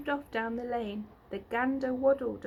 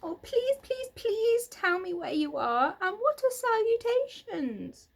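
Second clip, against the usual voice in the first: high, loud begging; frantic pleading; 11 semitones higher, 6 dB louder than usual. A narrator's reading. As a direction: beg frantically and loudly. Wilbur begs frantically.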